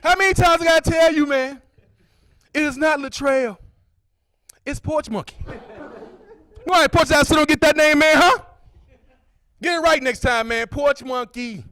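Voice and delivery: high, insistent correction; mild mock scolding. Friend insisting on his nickname, mildly scolding. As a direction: scold him for the wrong name.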